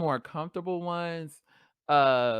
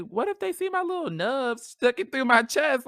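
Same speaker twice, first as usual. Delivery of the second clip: nervous worry; 11 semitones higher, 4 dB louder than usual. Retold or imitated. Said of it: "nervous because I was like".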